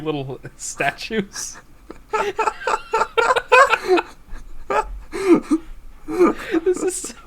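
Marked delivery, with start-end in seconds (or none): 2.1-7.1 laughing. Real, not acted. Helpless laughter.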